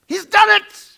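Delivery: very high, loud excited shriek; mad shouting. Retold or imitated. Caller on the train platform, a high loud shriek. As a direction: scream the news down the phone. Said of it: "this mad woman shouting it in my ear".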